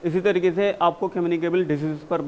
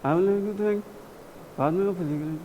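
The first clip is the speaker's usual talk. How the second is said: softer, half-hearted mumbled recitation, mocking the class; students' listless reading; 4 dB quieter than usual. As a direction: mimic students reading with no energy. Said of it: "पता है कैसे पढ़ते हो". (बेमन से पढ़ते छात्रों की नकल)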